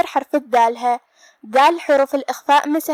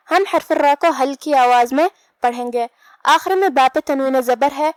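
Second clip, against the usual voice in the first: normal talking voice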